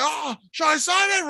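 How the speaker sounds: high-pitched, worked-up imitation; mock intense. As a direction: imitate a wrestler getting all intense. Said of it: "He gets all intense".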